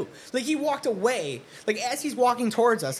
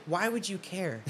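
softer, understated question; deadpan challenge; 2 semitones lower, 8 dB quieter than usual. Retold or imitated. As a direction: ask it quietly, unimpressed. First deadpan challenge to the threat.

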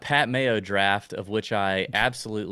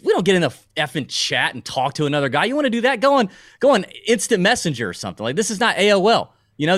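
high, loud indignant tirade; loud, high-pitched mock fury; mock outraged rant; 9 semitones higher, 8 dB louder than usual. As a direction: throw them out with hammed-up outrage, building to a shout. Mock tirade banning chat users.

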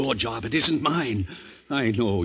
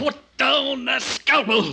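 stern command; slower than usual. Acted. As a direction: make him drop the blade. Friend orders him to stop.

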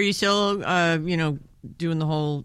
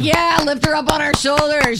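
cheering crowd; 8 semitones higher, 4 dB louder than usual. Retold or imitated. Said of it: "Women everywhere are like".

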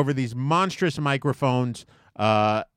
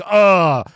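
a loud, high groan of exasperation; exasperated ugh; 8 semitones higher, 12 dB louder than usual. Retold or imitated. Narrator imagining brushing off the woman with a loud ugh. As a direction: let out a fed-up ugh. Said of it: "I couldn't just be like".

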